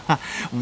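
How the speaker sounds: a short, breathy, embarrassed laugh; soft sheepish laugh; awkward laugh. Acted. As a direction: laugh to smooth over the lie.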